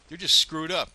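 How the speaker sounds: angry outburst. Real, not acted. Ranting to viewers about the county, loud and angry. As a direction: spit it out, fed up.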